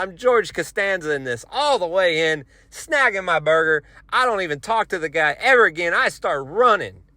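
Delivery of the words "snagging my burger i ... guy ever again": ordinary delivery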